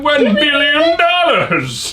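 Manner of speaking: slow, laughing evil villain voice; drawn-out villain line with a laugh. Retold or imitated. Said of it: "Austin Powers".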